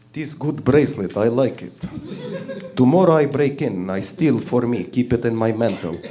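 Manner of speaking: put-on low, heavy Russian accent; hammed-up Russian brute, speaking broken English; Igor, Russian accent. Burly Russian thief admiring a bracelet, Russian accent. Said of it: "a guy called Igor, you know, from Russia".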